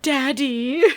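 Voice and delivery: mock Abigail. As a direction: call for a parent in a mock plea. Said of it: "maybe Abigail is like".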